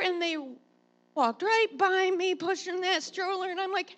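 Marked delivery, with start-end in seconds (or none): none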